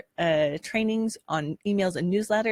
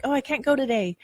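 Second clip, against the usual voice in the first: whiny excuse; 7 semitones higher, faster than usual. Retold or imitated. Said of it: "and you feel like".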